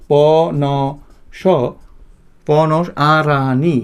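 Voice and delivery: drawn out word by word, very slow; slow syllable reading. Shown as a demonstration. Teacher dragging out each part so students can copy. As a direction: spell it out slowly for the class. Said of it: "এইভাবে আমরা পড়ব".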